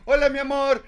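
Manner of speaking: high, loud, slow sweet-then-accusing wife voice; wife walks in on husband, mock sugary high voice; imitating wife. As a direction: mimic the wife's syrupy greeting turning to accusation. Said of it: "entra mi mujer al cuarto".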